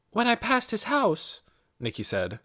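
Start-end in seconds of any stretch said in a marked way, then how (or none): 0.1-1.4 a hint of a girl's higher voice; lightly voiced young girl. A narrator's reading. Nicky answers Mrs. Beeson, lightly voiced as a girl. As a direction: lightly voice Nicky, eager and polite.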